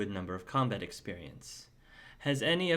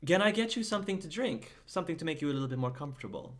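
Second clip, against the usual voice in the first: fast, higher hospitable host; Kane's voice; 6 semitones higher, much faster than usual. Acted. Kane offering the knight a drink, fast and high. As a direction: rush through the offer, eager to please.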